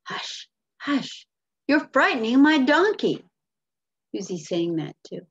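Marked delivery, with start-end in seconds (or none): 0.0-1.2 whispered, urgent shushing; farmer hushing. Acted. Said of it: "And the farmer says". 1.7-3.2 high, loud acted protest; scolding farmer. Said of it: "And the farmer says".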